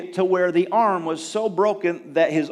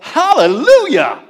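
loud, high, drawn-out hallelujah; joyful exclamation; 9 semitones higher, 10 dB louder than usual. Retold or imitated. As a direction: cry out praise in astonishment. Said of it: "she's like".

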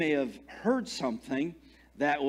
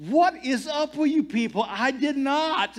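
high-pitched, louder mock indignation; retold outraged denial, hammed up; exasperated protest; 9 semitones higher, 4 dB louder than usual. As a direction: protest to everyone, voice pushed up.

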